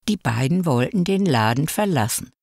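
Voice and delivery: plain reading voice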